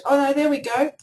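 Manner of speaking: ordinary talk, nothing marked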